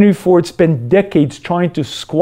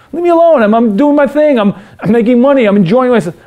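much higher and louder, fed up; defiant outburst; 7 semitones higher, 4 dB louder, faster than usual. Acted. Selfish man loudly tells his conscience to leave him alone. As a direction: shout it off, defensive and annoyed. Self defending, annoyed.

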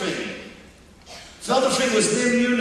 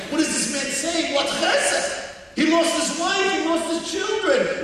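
higher-pitched, baffled protest; incredulous questioning; 6 semitones higher than usual. Retold or imitated. Onlookers watching a bereaved man give thanks, incredulous. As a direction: voice the onlookers' disbelief, pitch raised.

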